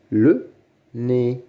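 "Le nez" with a pause after Le; syllable by syllable. Shown as a demonstration. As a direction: sound out "Le nez" as two separate beats. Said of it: "Lis les mots". (« Le nez » en deux temps bien détachés)

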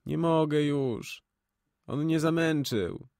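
slow, high moaning complaint. A narrator's reading. An exhausted woman moaning her complaint. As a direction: moan it out, worn down. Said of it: "Jęczy".

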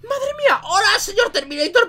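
high-pitched, amazed hello; surprised greeting. Real, not acted. Sorpresa al encontrar al Terminator. (saludo agudo y sorprendido)

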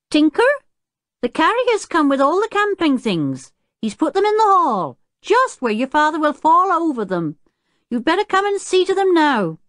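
high calling voice of the housekeeper. A narrator's reading. Housekeeper Jenny calling Tinker about the camping things, high and loud. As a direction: call from another room, bossy but kind. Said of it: "A voice called from the house".

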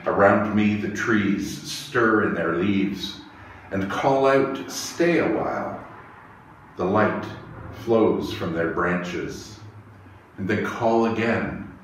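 ordinary talk, nothing marked

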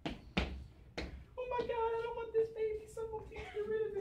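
furtive desperate parent. Acted. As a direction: confess it under your breath so nobody hears. Parent secretly dumping unwanted baby.

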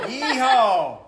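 loud braying hee-haw; donkey bray. Acted. Dad reading to the kids, braying like a donkey. Dad brays during children's story.

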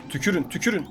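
ordinary talk, nothing marked

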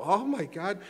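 high, loud mock reaction of students; imitated student outcry. Lecturer mimicking students' reaction to his team cheer, mock outcry. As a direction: imitate a class crying out in protest. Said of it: "And the students go".

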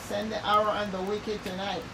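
normal talking voice

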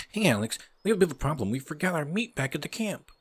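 high-pitched sidekick voice; high put-on game character voice; Bob. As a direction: pitch it up for a buddy reporting trouble. Voices Bob reporting a problem.

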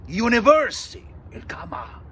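normal talking voice

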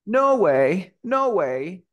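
"No way" por Norway, sin R y con vocal larga. Shown as a demonstration. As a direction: quita la R de Norway y alarga: "No way". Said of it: "Le quito la". ("No way" británico, primera vocal sostenida)

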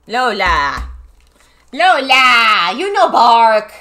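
loud call, rising in pitch; scolding the dog. Real, not acted. Owner to dog Lola who barked, loud scolding.

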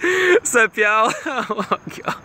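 helpless high-pitched laughing fit; cracking up mid-sentence; laughing hard. Real, not acted. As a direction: burst out laughing through the words. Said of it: "my stomach hurts. I love so hard".

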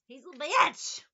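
loud, rising He's a bitch; emphatic yell. Shown as a demonstration. Calling the Leo out, a loud emphatic exclamation. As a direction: shout He's a bitch with a rise on bitch. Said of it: "Why do I keep saying that?".

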